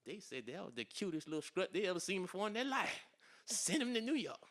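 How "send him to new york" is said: raised, loud crowd cry; excited calling out. Retold or imitated. Crowd reacting to his runway strut, excited retold shout. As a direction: call out as an impressed audience.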